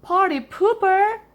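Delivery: very high, loud shriek; grouchy shriek. A narrator's reading. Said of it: "shrieked Aunt Grouchy".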